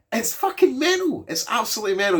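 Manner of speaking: gushing that the film is mad; excited outburst. Real, not acted. Urging viewers to watch the mad film, excited. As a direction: pitch leaps high on mental.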